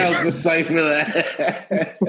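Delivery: chuckling through the words; laughing. Real, not acted. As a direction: laugh while you say it. Laughs at friend's joke.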